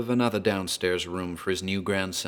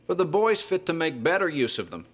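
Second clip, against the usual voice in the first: put-on a much higher, strained old man's voice; old Whately; 9 semitones higher than usual. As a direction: voice the old grandfather, pitched way up. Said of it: "he'd say".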